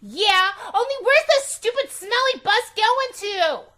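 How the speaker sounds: put-on very high, loud furious little girl; tantrum. A narrator's reading. Angry girl shouting at mother and teacher, high loud tantrum. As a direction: stamp your foot and shout it. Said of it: "I covered my ears and stamped my foot".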